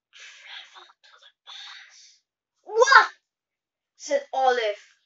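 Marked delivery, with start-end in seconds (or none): 0.1-2.2 whispered secret, slow and drawn out; hushed whisper. A narrator's reading. Basil reveals time travel secret. 2.6-3.1 loud, high cry of astonishment; amazed. A narrator's reading. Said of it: "so amazed at the possibility".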